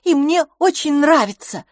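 high, loud and defensive; heated. A narrator's reading. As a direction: insist hotly, pitched well up. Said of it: "горячо ответила мисс Лафос". (горячо, с вызовом)

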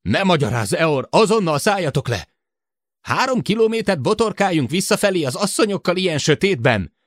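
high, loud, urgent shouting; heated argument. A narrator's reading. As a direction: argue forcefully over the radio. Heated radio argument, order versus protest. (heves, kiabáló vita)

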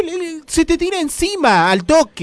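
excited triumphant remarks. Real, not acted. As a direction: crow about it to the audience. Excited joke continues.

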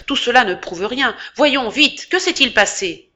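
high, loud impatient demand; brutal tone. A narrator's reading. Fencing master demanding what happened, brutal and impatient. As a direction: bark for the facts, fast and rough. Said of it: "d'un ton brutal".

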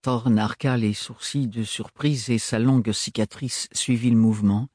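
ordinary delivery, nothing marked

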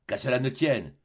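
ordinary delivery, nothing marked

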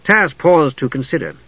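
plain reading voice

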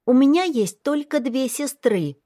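put-on high child voice, blaming; accusing little girl. A narrator's reading. Little girl calls her brother a liar, high and accusing. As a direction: point the finger like a hurt child.

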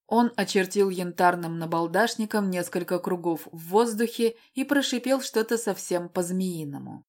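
ordinary delivery, nothing marked

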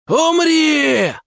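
shrill, very high monster shriek; hysterical shriek. Acted. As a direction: screech it in hysterics. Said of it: "истерично визжит Ак".

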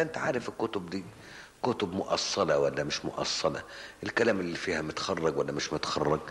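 ordinary delivery

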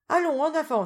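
high, loud eager cry; rally everyone to march on; eager exclamation. A narrator's reading. Teresa urging the group toward Santa Cruz, eager cry. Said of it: "s'écria Teresa".

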